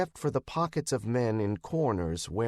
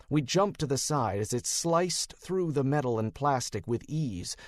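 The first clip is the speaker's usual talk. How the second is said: ordinary delivery, nothing marked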